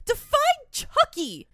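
very high, loud, slow acted cry; defiant yell. Woman running off answers the detective, defiant mock yell. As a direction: fling it back slowly at full pitch.

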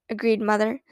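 ordinary delivery

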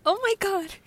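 loud, high shriek of disgust; shrieking disgust at a photo. Real, not acted. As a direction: recoil with a shrill ew. Reacting to disliked tweets photo.

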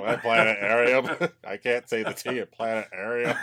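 low-pitched planetarium with no T; speech impediment. Retold or imitated. Host plays cartoon guy, low planetarium without T. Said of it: "the guy has the speech impediment".

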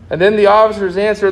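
ordinary delivery

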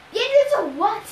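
high, loud, puzzled; startled question. A narrator's reading. Woken sleeper questions the captain, startled. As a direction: blurt out the question.

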